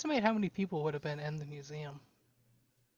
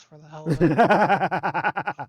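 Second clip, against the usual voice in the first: laughing; 12 dB louder, much slower than usual. Real, not acted. Player excitedly gambles luck points.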